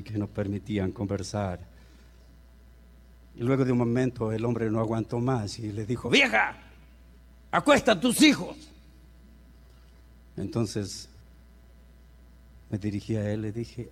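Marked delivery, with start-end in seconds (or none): 6.1-8.6 raised, higher snap at the wife; a fed-up man loses patience; husband barking at his wife to put the kids to bed; irritated husband's order. Retold or imitated.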